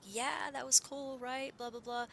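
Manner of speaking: lightly voiced, breezy girl friend; a hint of a carefree girl; casual friend voice. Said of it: "And she was like".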